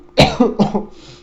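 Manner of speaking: a breathy, high, loud coughing fit; real choking cough. Real, not acted. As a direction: hack out a choking cough you can't hold back. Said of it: "that hit me hard".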